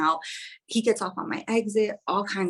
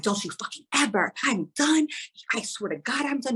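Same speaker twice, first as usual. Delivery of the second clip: furious outburst; 7 semitones higher than usual. Retold or imitated. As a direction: spit out the final word. Texting ex, final breakup outburst.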